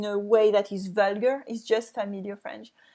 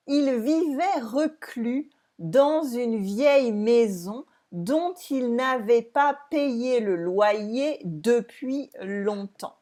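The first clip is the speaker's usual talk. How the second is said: slow model reading; 2 semitones higher, 3 dB louder, slower than usual. Shown as a demonstration. Teacher rereading the sentence to students, slow and clear. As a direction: read slowly and clearly for the class. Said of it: "Let's reread the sentence together".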